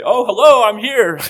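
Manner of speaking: high, loud, sing-song hello; mock-bright announcement through the door; cheery surprise greeting. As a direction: pop in and startle them playfully. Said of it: "I would sometimes open the door and say".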